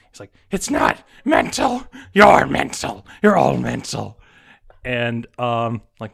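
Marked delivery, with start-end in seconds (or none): 0.5-4.2 manic accusation. Acted. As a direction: snap back at the doctor like a madman. Patient lashes out at the doctor.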